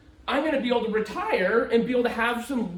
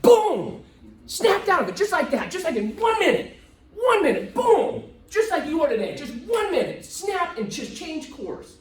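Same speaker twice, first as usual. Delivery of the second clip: high, loud, driving emphasis; a speaker fires up the crowd about a turning point, high and loud; emphatic build; 6 semitones higher, 4 dB louder than usual. Real, not acted. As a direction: hammer home the sudden change.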